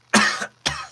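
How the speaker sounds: loud acted hacking cough; wounded villain's cough; beat-up cough. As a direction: cough hard mid-threat. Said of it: "One more line with the cough".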